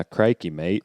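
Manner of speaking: mock Australian catchphrase; a touch of Aussie; Australian accent. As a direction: toss it off in a light Australian accent. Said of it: "What about Australian?".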